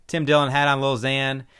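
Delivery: ordinary talk, nothing marked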